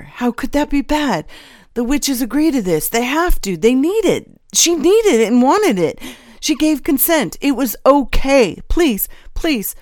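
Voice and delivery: high, loud, frantic pleading; building to an anguished wail; desperate self-justification. A narrator's reading. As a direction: beg the judges to believe you. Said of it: "Still trying to justify himself".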